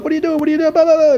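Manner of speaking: very high, babbling mock confusion; feigned cluelessness. Son playing clueless as dad accuses him, high mock confusion. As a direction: play dumb in a hammed-up high voice. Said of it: "I'm playing the, I don't know what's going on".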